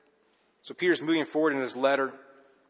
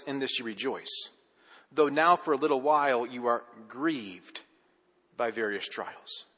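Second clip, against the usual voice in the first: scripture reading; 3 semitones lower than usual. Acted.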